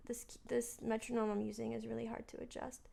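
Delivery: half to herself while fiddling with the metronome; a whispered grumble about the metronome; whispered aside. Real, not acted. Muttered aside while adjusting metronome.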